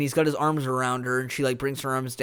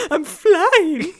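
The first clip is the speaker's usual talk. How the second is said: put-on high, loud girlish squeal; hammed-up girlish cry of wonder; mock little girl; 9 dB louder, much slower than usual. Mocking her line at the bow, exaggerated little-girl squeal. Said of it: "Like she's a little girl".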